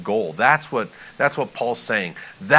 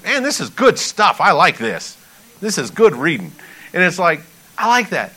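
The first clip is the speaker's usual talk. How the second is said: pleasantly surprised, higher-pitched reaction; delighted approval; 7 semitones higher, 6 dB louder than usual. Acted. Pastor acting a reader enjoying the blessings, delighted. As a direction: relish it like a thrilled reader.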